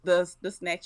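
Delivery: ordinary talk, nothing marked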